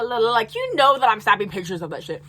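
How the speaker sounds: normal talking voice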